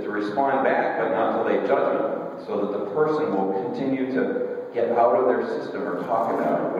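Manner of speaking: normal talking voice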